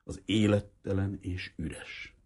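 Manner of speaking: plain reading voice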